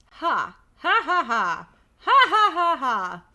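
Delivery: gloating stage laugh in separate bursts; mocking fake laugh. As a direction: belt out a fake, taunting laugh. Book's gloating laugh at reader.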